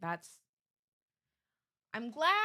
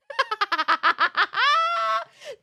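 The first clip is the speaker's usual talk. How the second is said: high, loud burst of laughter; loud cackle; 14 semitones higher, 9 dB louder than usual. Real, not acted. Streamer laughing at the translation attempt, loud cackle. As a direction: cackle helplessly at full volume.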